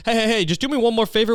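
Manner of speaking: high-pitched, interrupting mock request; mock friendly aside. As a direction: break off the rant to ask sweetly. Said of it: "then you just go".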